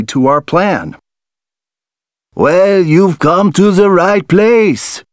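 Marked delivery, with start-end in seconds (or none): 2.3-5.0 higher, theatrical French farmer voice; a hint of a mustache twirling villain. A narrator's reading. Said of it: "says Farmer Francois, twirling his mustache".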